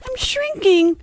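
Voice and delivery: sudden high alarmed cry; high startled gasp of fear. Acted. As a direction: panic as you feel yourself shrink. Alice realises she is shrinking.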